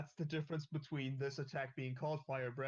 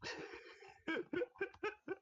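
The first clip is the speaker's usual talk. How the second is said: high laugh. Real, not acted. Laughs at the joke.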